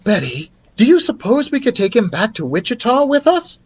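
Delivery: put-on sweetly pleading young teacher. Teacher Mary Lou voiced, hopeful.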